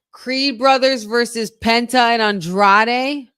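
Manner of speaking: high, loud, slow hype question; thrilled disbelief at the matchup; excited. Real, not acted.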